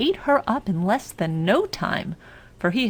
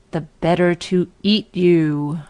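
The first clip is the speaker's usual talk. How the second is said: menacing wolf; 6 dB louder than usual. Acted. Wolf reveals himself.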